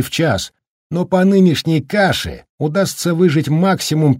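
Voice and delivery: лёгкий голос деловитого местного мужика; чуть выше, живо объясняет; голос Даркина. Acted. (деловитый местный мужик, слегка озвученный)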